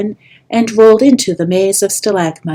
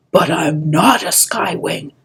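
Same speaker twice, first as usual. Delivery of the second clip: ordinary delivery, nothing marked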